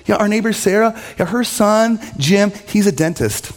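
high, sugary needling questions; fake-friendly digs at the son; passive-aggressive father. Father needling his son about the neighbor's dentist son, passive-aggressive. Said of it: "the passive-aggressive route".

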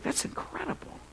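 breathless high whisper of wonder; hushed, awestruck high whisper; awed whisper. Retold or imitated. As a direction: whisper it in amazement. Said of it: "It's like".

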